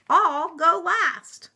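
slow, high, loud offer; bus character voice. Acted. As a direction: call it out slowly as the bus. Bus speaks in the story.

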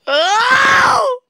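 high, loud wail of "oh"; long, rising cry of dismay. Real, not acted. Player hits a new setback, loud dismayed wail.

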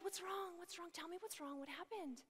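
imitated hushed, worried comforting; gentle concern. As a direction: ask softly, coaxing a crying child to talk. Bending to comfort crying child.

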